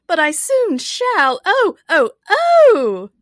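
high, loud wail, building to shrieks; wailing Queen. A narrator's reading. The Queen expects to prick her finger, wailing. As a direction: build from a drawn-out lament into loud cries. Queen wails at pain to come.

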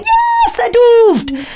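very high, exultant prank-caller squeal; Dom Jolly. Retold or imitated. Co-host imitates a prankster finally understood by staff, prank-caller impression. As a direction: shoot way up in comic delight at being understood. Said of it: "And he'd be like".

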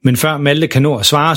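ordinary delivery, nothing marked

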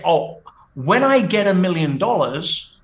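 very slow, stretched-out phrasing; slow, spelled-out quote. Acted. Coach voicing people who wait for a million dollars, slowly. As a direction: drag out each phrase to expose the logic.

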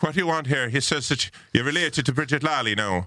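high singsong Irish woman; Irish landlady accent. Retold or imitated. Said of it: "the woman who runs the B and B".

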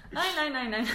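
high, loud string of refusals; mock panicked refusal. Viewer rejects the traumatising film, mock panicked protest. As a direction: refuse it in playful horror.

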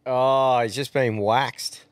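ordinary talk

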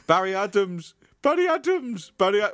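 searching for Barry's voice, trying it out. Acted. Narrator trying out a voice for Barry Adams. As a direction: several takes at a character voice, still unsure. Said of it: "what was Barry's voice?".

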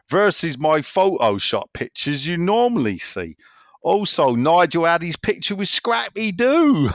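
high, loud West Country read-out; Doddsy's Somerset accent. Acted. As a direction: lay on a thick Somerset burr. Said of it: "I will do his accent".

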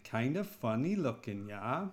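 Fargo-style Minnesota lilt; a Brit trying on a Minnesotan accent. Acted. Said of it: "it's sort of like the Fargo accent".

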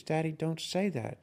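a hint of a small daughter telling Daddy off; scolding little girl.

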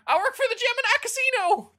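high puppet voice. Acted. Puppet repeats answer.

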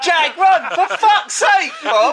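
hammed-up frantic warning yell; mock panicked shout. Host imitating brother yelling at Jag, laughing shout. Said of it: "stop shouting your name out".

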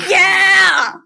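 loud shouted yeah; roar. Acted. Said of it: "I roared".

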